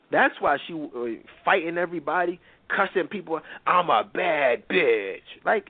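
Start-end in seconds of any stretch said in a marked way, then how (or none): 3.7-5.2 slow, drawn-out mock tough-girl boast; mocking imitation. Vlogger mocking Mimi's boast, slow mock swagger. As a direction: sneer it out like you're parroting her. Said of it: "Like, who talks like that?".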